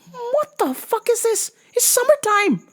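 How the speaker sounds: very high, squeaky indignant voice; high mock outrage; shrill outraged squeal. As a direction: squeal it in disbelief at the sky. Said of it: "and I said".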